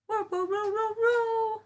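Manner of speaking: high squeaky dog whirring; dog noise imitation. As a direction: mimic the little dog's whine. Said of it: "My little dog likes to go".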